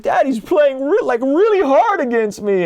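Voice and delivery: high-pitched imitation of a young son; child voice. Father imitating his five-year-old complaining in a high voice. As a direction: squeak it out like a small upset kid. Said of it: "And he's like".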